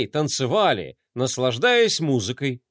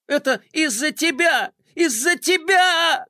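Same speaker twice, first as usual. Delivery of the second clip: tearful accusation; 12 semitones higher than usual. A narrator's reading. Adjutant blames Flay, wailing in tears. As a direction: wail the blame through tears. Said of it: "зашёлся в горьких рыданиях".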